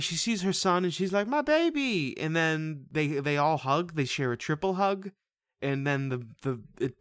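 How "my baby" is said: high, drawn-out mock wail; mock tearful cry. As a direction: imitate an overwrought mother's cry. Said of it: "she just starts crying".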